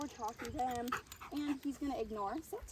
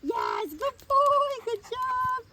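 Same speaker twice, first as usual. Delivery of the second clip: much higher and louder, slow stretched praise; excited party praise; 10 semitones higher, 12 dB louder, much slower than usual. Shown as a demonstration. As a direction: celebrate the dog arriving. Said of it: "higher pitched tones of voice to be exciting".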